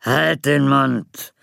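slow growled command. Acted. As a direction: growl it out, menacing. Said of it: "knurrte der Unsichtbare".

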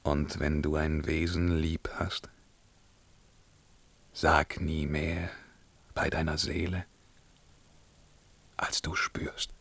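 hushed and grave; solemn whisper. Acted. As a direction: whisper it like a last confession. Intimate final counsel.

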